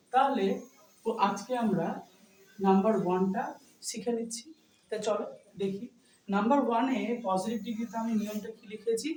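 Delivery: ordinary delivery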